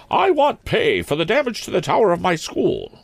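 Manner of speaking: put-on high-pitched, prim old maid principal; severe schoolmistress. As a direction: demand it in a high, stern headmistress voice.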